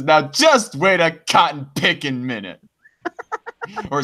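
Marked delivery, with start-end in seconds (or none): 0.0-2.6 hammed-up chat quote; mock indignation. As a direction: act out a put-on outburst. Reads chat message with mock indignation.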